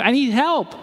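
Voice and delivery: a hint of a baby's cry for help; lightly voiced crying baby. Preacher acting unanswered crying babies, mild high plea. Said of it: "You're those crying babies".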